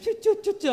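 quick high "choo" sounds; mimed quick skimming noise. Retold or imitated. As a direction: zip through with loud little chirps. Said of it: "we just went like".

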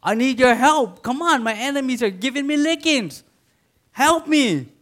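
high, loud imitated cry for help; desperate plea. Preacher voicing David begging God for help. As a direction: call out to God like a drowning man. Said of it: "probably asking God".

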